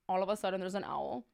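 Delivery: ordinary delivery, nothing marked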